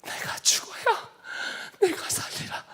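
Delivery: high, hushed and slow; whispered words of Jesus. Retold or imitated. Jesus answering the weeping man, softly whispered. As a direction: breathe it out gently like a vision speaking. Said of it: "이렇게 말씀하셨어요".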